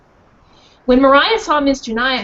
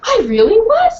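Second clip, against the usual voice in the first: proud dog; 9 semitones higher, 5 dB louder than usual. Acted. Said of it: "said Mariah".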